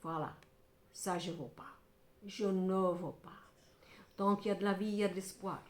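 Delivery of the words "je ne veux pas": a slow whispered refusal; chuchoté. Acted. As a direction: breathe the words, no voice, slowly. Repeats refusal, then whispers it. (refus chuchoté lentement)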